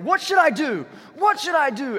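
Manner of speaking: high, loud mock bellow; hammed-up royal outburst; frantic king. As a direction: shout it as an exasperated king. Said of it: "the king is like".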